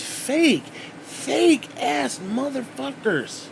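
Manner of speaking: high, slow, strained jab; contemptuous insult. Real, not acted. Ranting at fake people.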